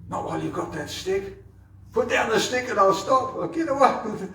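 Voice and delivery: put-on a playful boy bargaining with his mother from a distance; a boy darting away, daring her; cheeky child Krishna. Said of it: "And Krishna says".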